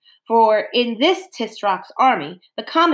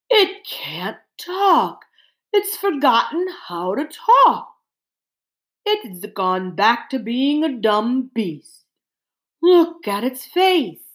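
dismayed bear; 3 semitones higher, slower than usual. A narrator's reading. Bear telling the animals the cat is dumb, slow dismay. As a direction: draw the words out in slow dismay. Bear's voice in the narrated story.

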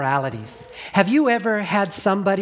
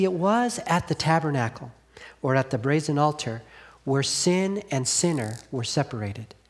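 ordinary talk

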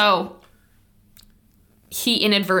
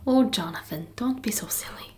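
put-on soft, breathy Irish-accented tease; mock Irish whisper; 2 semitones higher, 7 dB quieter than usual. Co-host echoing Mare's line in a quiet mock Irish accent. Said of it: "That was a perfect Irish accent".

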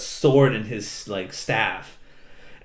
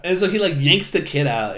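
ordinary delivery

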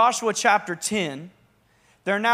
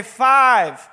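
called-out word; 3 semitones higher, 7 dB louder than usual. Shown as a demonstration. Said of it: "turn to your neighbor and say".

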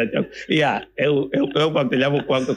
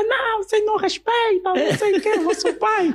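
high, whiny mother voice; mock mother scolding. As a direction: squeal it like a hurt mom.

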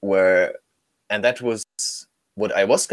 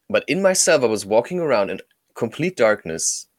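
usual speaking voice

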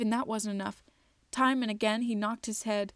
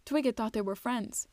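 plain reading voice

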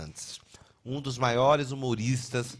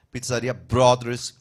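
ad read; 8 dB louder, slower than usual. Acted. Sponsor shout-out.

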